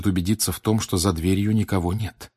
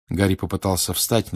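ordinary delivery, nothing marked

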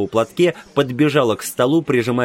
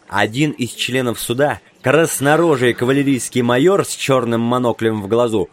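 ordinary delivery, nothing marked

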